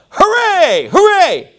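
high, drawn-out cheering yells; excited yell. Acted. Preacher imitating Mongolians ending prayers, excited high yelling. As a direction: whoop it out in mock celebration. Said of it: "They get excited and yell".

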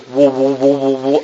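low whooshing woo, fading away; imitated whooshing rod. Preacher showing how the sailboat rod swung, low whoosh. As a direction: mimic a rod whipping through the air. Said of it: "when you swing it, it goes".

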